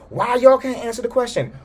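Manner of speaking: very high, loud mock tantrum; throwing a fit. Boss throwing a fit at the dinner table, high mock yell. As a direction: pound the table and demand answers. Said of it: "throwing a huge fit".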